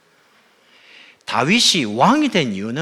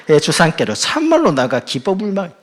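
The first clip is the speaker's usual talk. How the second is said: loud, higher-pitched Jeolla dialect; laughing Jeolla-accented flourish; 6 semitones higher, 9 dB louder than usual. Acted. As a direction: finish big in Jeolla dialect, amused. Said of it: "전라도 버전입니다".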